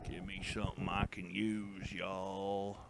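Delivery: soft, drawn-out plea to nobody; a soft, weary appeal to the internet for a deal; quiet plea. Real, not acted. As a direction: murmur it, half giving up. Pleading with the internet again.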